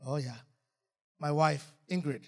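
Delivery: normal talking voice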